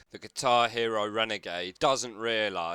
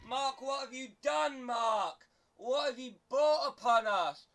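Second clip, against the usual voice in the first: very high, hammed-up wailing shout; mock lament; 13 semitones higher than usual. Commentator blaming Mark for the chaos, hammed-up wail. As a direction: melodramatic cry to the heavens.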